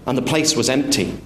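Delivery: usual speaking voice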